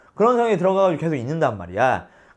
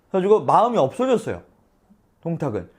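ordinary delivery

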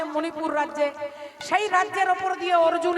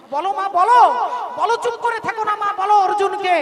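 loud, pressing, higher; insistent demand; 3 semitones higher, 7 dB louder than usual. Acted. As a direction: son forcing his mother to answer. Son demands answer from mother.

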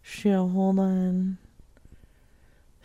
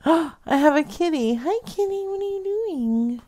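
high cooing to the kitty; high sing-song pet talk; cat talk; 9 semitones higher than usual. Real, not acted. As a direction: greet the cat sweetly. Greeting the cat.

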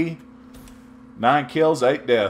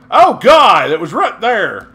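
high, loud "Oh, God!"; loud exasperation; 8 semitones higher, 11 dB louder than usual. Real, not acted. Gamer realizing the item was right there, loud exasperated shout. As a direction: burst out in disbelief.

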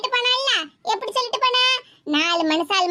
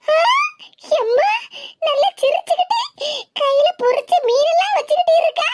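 astonished exclaiming; 6 semitones higher, 4 dB louder than usual. Acted. Finds her mother laughing merrily.

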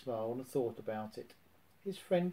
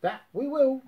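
much higher, loud cheerful cry; happy cry; 11 semitones higher, 8 dB louder than usual. A narrator's reading. Tigger happily agrees to fetch friends, excited. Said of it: "Tigger cried happily".

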